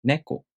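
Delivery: "Neko" starting high, falling on ko; Japanese pitch accent. Shown as a demonstration. Teacher repeating the example, "Neko" dropping after ne. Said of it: "pitch drops after the first syllable".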